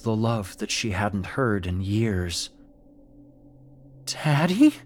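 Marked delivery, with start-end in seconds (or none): 4.0-4.7 whimpered. Acted. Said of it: "she whimpered".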